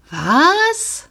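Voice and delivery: high disbelieving question; startled. A narrator's reading. Girl shocked at the dismount advice, disbelieving. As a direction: blurt out in disbelief.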